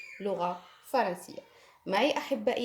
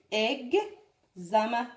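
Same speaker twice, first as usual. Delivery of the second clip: "Examen" said slowly but less drawn out; slow model word; much slower than usual. Shown as a demonstration. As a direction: repeat "Examen" slowly, a bit quicker. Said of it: "تعودوا على نطقه". (نطق بطيء لكلمة Examen)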